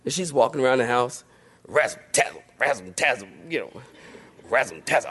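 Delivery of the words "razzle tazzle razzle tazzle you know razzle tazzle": low mock muttering of an angry mom; grumbling mom. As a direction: mutter it low like a fuming mother. Mom grumbling around the house.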